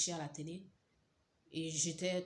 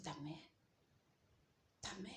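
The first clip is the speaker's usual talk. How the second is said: stunned hush; 11 dB quieter than usual. Retold or imitated. Shocked reply to husband.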